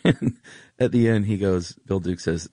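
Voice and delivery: usual speaking voice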